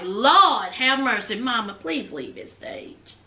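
imitating a mortified son; weary plea. Son begging his crying mother.

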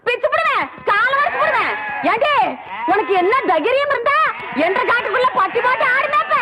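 high-pitched quarrelsome threat; angry scolding. Acted. (உயர்ந்த சுருதியில் கோபத் திட்டு)

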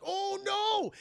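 high alarmed oh no; alarmed cry. Retold or imitated. Khan's arm gets swallowed, high startled cry. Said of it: "until Khan's like".

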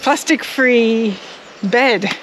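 slowly sounding out Plastic-free; slow careful repeat. Real, not acted. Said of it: "Oh, my words today".

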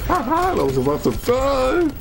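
laughing self-quote. Retold or imitated. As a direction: squeal it like you nearly died. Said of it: "I'm like".

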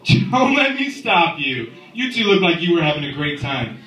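high, slow mock-casual dad; hammed-up chill father; mock permissive dad. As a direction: play it cool as the dad walking in. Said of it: "I'm gonna be like".

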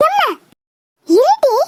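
ordinary talk

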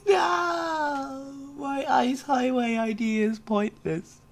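high, whiny mock wail; hammed-up crying. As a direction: lament it like a tragic loss. Laments failed ice highway.

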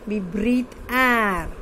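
slow, deliberate, word by word; slow dictation. Shown as a demonstration. Teacher reads a textbook sentence aloud to pupils, slow dictation. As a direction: read it slowly so children can follow. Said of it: "teacher dictates a sentence twice".

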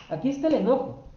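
ordinary talk, nothing marked

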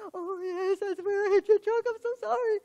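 high, loud, tearful wail of sorry; hysterical sobbing apology. Retold or imitated. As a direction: blubber it out like you're falling apart. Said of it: "crying, hysterical".

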